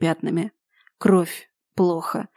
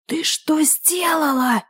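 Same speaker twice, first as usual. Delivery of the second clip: furious hiss; 7 semitones higher, 4 dB quieter, slower than usual. Acted. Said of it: "в ярости прошипел Йен".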